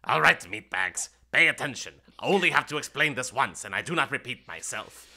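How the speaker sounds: loud, bossy imp barking orders at the party. Acted. Imp guide scolding the adventurers, loud and bossy. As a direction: sneer it like a petty demon guide. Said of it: "as he goes".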